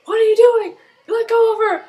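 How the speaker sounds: high, loud, outraged shout; alarmed bystanders. Retold or imitated. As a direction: yell at him to let her go. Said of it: "these other people are like".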